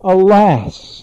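loud, long falling cry of grief; anguished cry. A narrator's reading. Narcissus cries out over his reflection in anguish. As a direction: let the alas break out in anguish. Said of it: "when Narcissus cried out".

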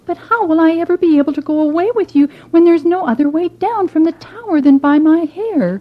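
put-on a fretting girl; worried maiden.

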